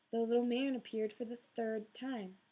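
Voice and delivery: plain reading voice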